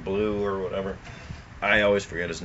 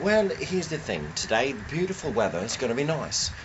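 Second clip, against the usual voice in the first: a mock English weather forecast; a hammed-up posh English voice; English accent; 3 semitones higher than usual. Friend imitating an Englishman on the weather, mock English accent. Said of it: "England, you got".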